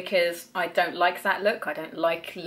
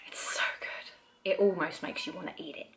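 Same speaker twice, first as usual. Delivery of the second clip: whisper; 2 semitones lower, 6 dB quieter than usual. Real, not acted. Swooning over lip balm smell.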